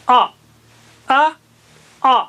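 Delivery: teacher drilling heavy a. Shown as a demonstration. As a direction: repeat آ thick and high. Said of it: "زبان کو موٹا کیجئے، اونچا کیجئے".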